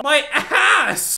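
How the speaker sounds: usual speaking voice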